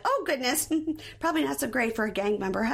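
high, breezy mock mom; a high-pitched imitation of a flustered mom; mom's reaction. Recapper voicing the mom's flustered reply. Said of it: "And she's like".

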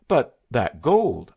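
loud, slow exclamation; exclaiming. A narrator's reading. Narrator alone with Sterling, loud exclamation. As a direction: burst out about the missing gold. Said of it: "I exclaimed".

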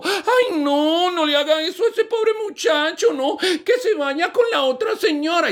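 high falsetto pleading; pleading mother-in-law. Acted. The mother-in-law begs the judge to spare him, high. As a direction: beg high with a laugh.

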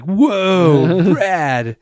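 loud, high, drawn-out whoa; exaggerated thrilled reaction, settling slightly on the last word; mock-impressed kid. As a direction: gush it like an awestruck kid. Boy admiring gory photos.

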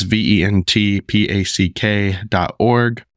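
slow, letter by letter; measured pace, each letter separate; spelled out. Real, not acted. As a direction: spell it out clearly for listeners. Spells out the email domain.

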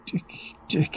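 a hushed, breathless whisper of a name; frightened whisper. Acted. Tom whispers to Dick, still haunted, in a frightened hush. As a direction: whisper his name as if the ghost could hear. Tom gasps out his dread.

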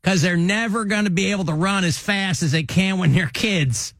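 emphatic comic safety-talk voice; J J Bittenbinder. Retold or imitated. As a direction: imitate a cop lecturing on kids. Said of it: "this is what you would say".

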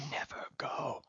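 hushed whisper; whispered menace under the breath; very low whisper. A narrator's reading. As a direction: breathe it darkly. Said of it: "began very low".

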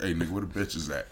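very soft, low deadpan; mock-tough kid. Adorable kid as imagined by adult, quiet mock-tough demand. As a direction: undersell the tough talk, hushed and low. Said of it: "turned that into something mad".

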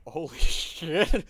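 slow, drawn-out amazed gasp of words; stunned relief. Retold or imitated. Rescued Empire reacting to the orcs, slow stunned relief. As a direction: let it out slowly in disbelief.

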